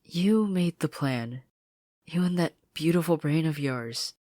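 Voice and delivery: slow, emphatic praise; proud and warm. Acted. Anne telling Marcy she saved them, slow. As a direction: praise her slowly and proudly.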